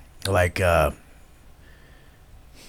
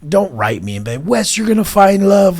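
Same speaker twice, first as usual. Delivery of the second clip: high, loud mocking singsong; mock sappy fan voice; mocking well-wishers; 11 semitones higher, 6 dB louder than usual. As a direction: parody the sappy fans, pitched way up. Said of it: "people are like".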